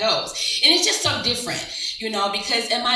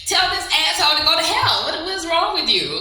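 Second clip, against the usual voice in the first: indignant younger self; 7 semitones higher than usual. Retold or imitated. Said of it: "I'm like".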